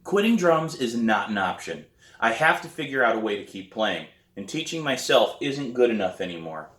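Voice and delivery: plain reading voice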